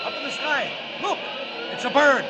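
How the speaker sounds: high, loud, astonished cries; excited shouting. Acted. Onlookers spot Superman in the sky and shout excitedly. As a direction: point up and shout in wonder.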